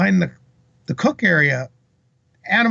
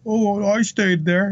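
higher, slow, drawn-out hedging; retold unconvincing fib; 6 semitones higher, slower than usual. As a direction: stall through a little fib, imitating him. Retold worker fibbing about staying.

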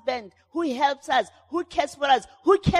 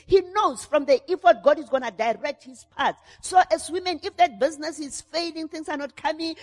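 ordinary talk, nothing marked

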